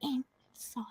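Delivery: put-on slow hushed whisper to a child; whispering inside voice as to a nephew. Shown as a demonstration. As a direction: barely breathe it, like shushing a nephew. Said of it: "As I tell my nephew".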